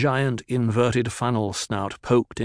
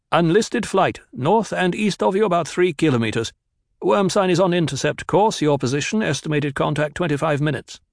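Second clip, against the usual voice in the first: radio report; 7 semitones higher than usual. Acted. Kynes reports worm over radio.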